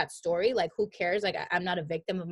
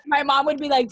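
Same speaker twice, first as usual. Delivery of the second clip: usual speaking voice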